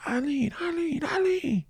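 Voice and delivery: high, drawn-out crowd cheer calling a name; cheering her name. Retold or imitated. Homeless guys at the parade cheering for his mom, high calling out. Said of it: "we hear all these cheers".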